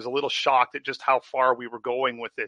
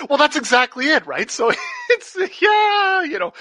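laughing; 10 dB louder than usual. Real, not acted. Laughing at wife's concern.

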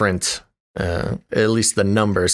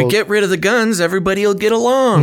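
mocking sarcastic voice; 9 semitones higher, faster than usual. Mocking naive gun view.

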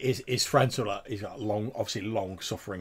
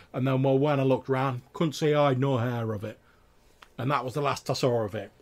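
raised, louder rustic voice spinning a tall tale; insistent tavern tale-teller; 6 semitones higher, 8 dB louder than usual. Acted. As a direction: tell it like an excited country trapper.

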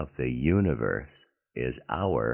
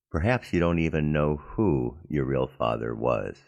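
normal talking voice

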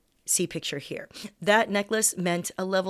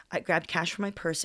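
plain reading voice

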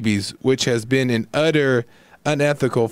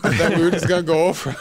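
laughing; 2 semitones higher, faster than usual. Real, not acted. Laughing at own unpreparedness.

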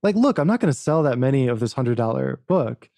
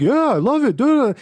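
mocking fake enthusiasm; 11 semitones higher, 3 dB louder than usual. Mocks empty enthusiasm of others.

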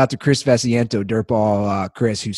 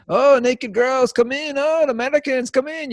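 street tout calling; 14 semitones higher than usual. Retold or imitated. Street touts luring tourists.

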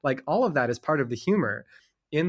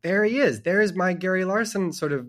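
excited; 7 semitones higher, 5 dB louder than usual. Retold or imitated. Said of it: "And it was like".

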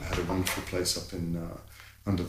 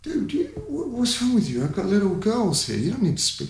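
much higher, pleading outrage; retold dad protecting his girls, raised; indignant plea; 13 semitones higher than usual. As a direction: push back at him, voice up high. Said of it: "I'm like".

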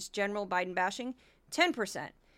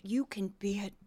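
whisper; 2 semitones lower, 6 dB quieter than usual. Retold or imitated. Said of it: "and a brief Kari Lake".

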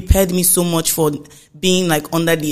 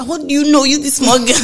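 higher, louder taunting voice; mocking schoolgirl; 9 semitones higher, 6 dB louder than usual. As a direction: sneer at a small girl. Voicing schoolmates mocking her.

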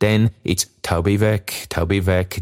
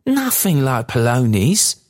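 slow, higher gleeful denial; delighted; 6 semitones higher, 3 dB louder, slower than usual. A narrator's reading. Daughter teases father over his wrong guess, delighted. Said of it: "cried Meg, delighted".

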